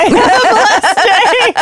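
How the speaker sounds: slow, high drawn-out sign-off; mock reviewer voice. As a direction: echo the review's sign-off, hammed up.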